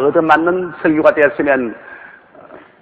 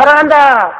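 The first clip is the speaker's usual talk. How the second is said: high, loud holler back; echoed love call; 9 semitones higher, 5 dB louder than usual. Acted. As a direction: ring it out like an echo. Echo repeats it.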